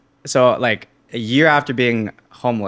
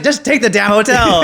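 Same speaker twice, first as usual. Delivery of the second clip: high, loud exasperated urging; freaking out; 11 semitones higher, 7 dB louder than usual. Retold or imitated. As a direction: urge your friend, panicking. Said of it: "I'm, like, freaking out".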